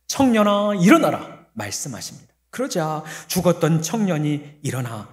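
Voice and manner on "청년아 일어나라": much higher and louder command; commanding call. Acted. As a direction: call the dead to rise. 예수님 명령 재연. (높고 크게 명령하듯)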